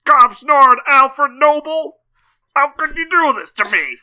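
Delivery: high, loud, wailing prospector; hammy old prospector. Pretend. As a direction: ham up a wounded old miner. Dying prospector continues.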